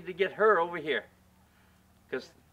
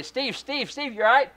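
partner calling; 7 semitones higher than usual. Retold or imitated. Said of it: "I hear my partner say".

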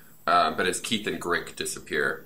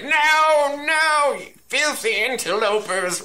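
very high, louder squeaky Keith voice; NPC voice; 4 dB louder, slower than usual. Acted. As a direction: shriek it out high like a panicked little villain. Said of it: "All my N P Cs have that voice".

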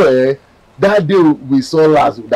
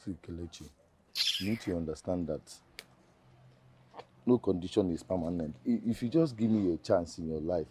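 low, very soft appeal; hushed pleading; 7 semitones lower, 19 dB quieter than usual. Acted. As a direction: reason with her softly. Suitor pleads after being rejected.

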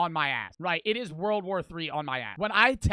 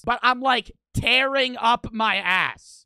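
loud emphasis; 2 semitones higher, 7 dB louder, slower than usual. Real, not acted.

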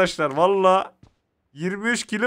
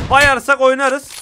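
louder, slow, higher bayarsak oynarız; hyped; 4 semitones higher, 6 dB louder, slower than usual. Real, not acted. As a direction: draw it out with gleeful hype. Hyping team before ambush. (coşkuyla uzatılmış vaat)

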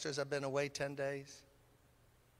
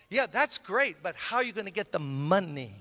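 pushing harder; 3 semitones higher, 6 dB louder than usual. Retold or imitated. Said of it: "caller pressing again".

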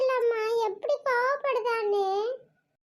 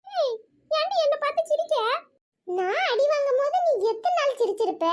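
ordinary talk

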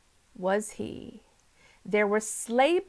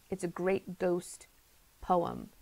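plain reading voice

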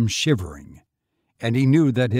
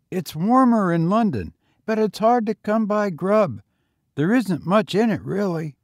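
put-on higher, eager boy voice; young boy; 8 semitones higher, 5 dB louder than usual. A narrator's reading. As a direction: pitch up for a street boy. Boy talks.

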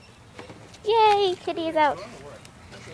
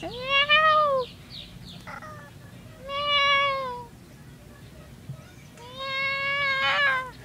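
long, high-pitched mewing; imitated cat meow; 11 semitones higher, 6 dB quieter, much slower than usual. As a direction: mimic a cat with drawn-out meows. Meowing at the cat.

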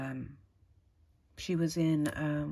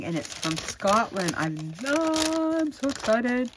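ordinary delivery

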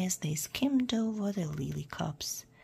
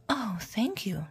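mildly delighted princess; lightly acted storybook relief; happy; 3 semitones higher than usual. A narrator's reading. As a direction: thank with a touch of joy. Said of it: "said the princess happily".

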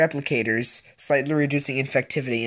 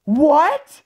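shocked; 12 semitones higher, 6 dB louder than usual. Real, not acted. Shocked by the game's sudden cure.

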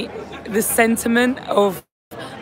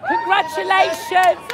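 shouted congratulations; 11 semitones higher than usual. Real, not acted. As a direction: cheer it at the Swedes behind you. Calling to Swedish fans.

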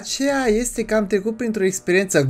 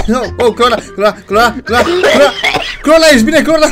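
laughing, louder and higher calls; alarmed calling; 5 semitones higher, 5 dB louder than usual. Real, not acted. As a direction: call the name repeatedly, building in urgency. Calls to laughing, choking friend.

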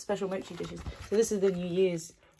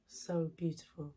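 breathy whisper, barely voiced, softer and lower; whispered praise; 4 semitones lower, 7 dB quieter than usual. Shown as a demonstration. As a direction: whisper "So beautiful." in awe, no voice at all. Repeats praise, then whispers it.

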